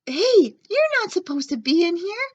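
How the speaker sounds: high, loud scolding yell. A narrator's reading. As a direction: chase the dog out of the kitchen. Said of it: "one of the lunch ladies yelled".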